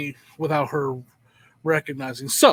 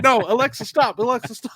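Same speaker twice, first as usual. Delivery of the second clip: urgent command; 5 semitones higher, 5 dB louder than usual. Retold or imitated. Retold frantic command to Alexa.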